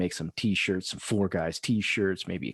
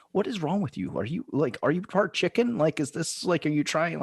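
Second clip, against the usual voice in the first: mock disbelief; 7 semitones higher than usual. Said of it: "I was like".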